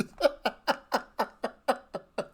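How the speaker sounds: real laughter bubbling back up; helpless laughter. Real, not acted. Vlogger laughing at the video yet again, helpless laughter. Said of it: "I laugh every time I see it".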